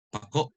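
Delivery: PAKO with short PA, stressed KO stopped short; final stress, glottal stop. Shown as a demonstration. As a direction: say PAKO fast, catch after KO. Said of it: "pronounced fast with the accent on the last".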